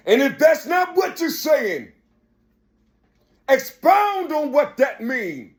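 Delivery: fiery sermon cadence rising to shouts; preaching. Acted. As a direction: preach it hard to the crowd. Preaching at full force.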